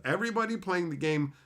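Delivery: ordinary talk, nothing marked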